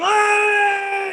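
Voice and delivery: high cheering yell; cheering shout. Retold or imitated. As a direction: whoop like a cheering crowd. Crowd cheering.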